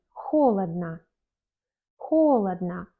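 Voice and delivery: "холодно" with stressed first o, ending in a; slow, careful "холодно", said kholadna; reduced final O. Shown as a demonstration. Teacher giving an adverb as example, reduced final O. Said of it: "on prononce comme A".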